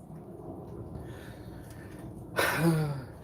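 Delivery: long drawn-out sigh. Real, not acted. Long sigh before speaking.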